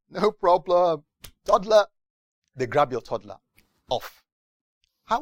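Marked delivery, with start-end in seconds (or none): 0.1-1.9 tax man. Retold or imitated. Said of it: "tax man answers".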